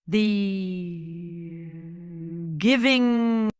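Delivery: slow, laboured utterance swelling on the second word; sorrowful plant creature. A narrator's reading. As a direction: stretch each word like a slowly opening mouth. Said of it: "Its tiny leafy mouth opened slowly".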